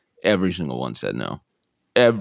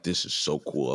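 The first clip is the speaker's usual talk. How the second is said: quietly impressed; 2 semitones lower, 9 dB quieter than usual. Retold or imitated. Naive student taking notes, quietly impressed. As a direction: say it softly, as if to yourself.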